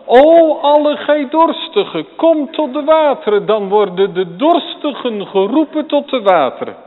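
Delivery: very loud, high prophetic cry; preaching at full force. Acted. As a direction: cry it out to the thirsty. Preacher proclaims Isaiah's call.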